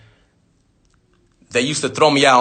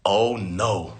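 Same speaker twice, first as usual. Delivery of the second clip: dread; 7 semitones lower, 7 dB quieter than usual. Retold or imitated. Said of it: "I used to always be like this".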